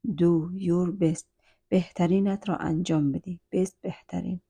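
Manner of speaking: ordinary talk, nothing marked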